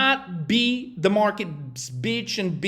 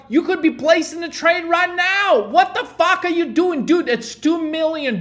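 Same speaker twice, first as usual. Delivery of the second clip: higher, louder, exasperated yell; furious retold yell; 6 semitones higher, 4 dB louder than usual. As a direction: tear into the excuse-making investor. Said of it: "But I'm like".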